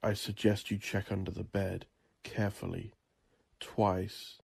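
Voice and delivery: usual speaking voice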